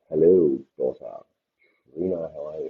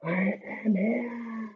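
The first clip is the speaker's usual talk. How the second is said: much higher, slow filtered voice; voice changer; 14 dB quieter, much slower than usual. Shown as a demonstration. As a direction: stretch it out through a pitch-up effect. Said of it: "I wanna change my voice".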